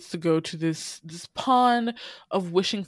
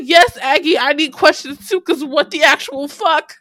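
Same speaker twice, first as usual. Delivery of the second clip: much higher and louder, exasperated; excited disbelief; 10 semitones higher, 11 dB louder than usual. Real, not acted. Host reacting to the twist, loud incredulous rant. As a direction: throw your hands up in disbelief.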